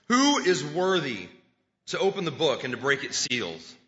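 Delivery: strong, loud proclamation; loud proclaiming angel. A narrator's reading. Preacher reads out the angel's challenge to all, loud proclamation. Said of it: "I saw a strong angel proclaiming with a r-, loud voice".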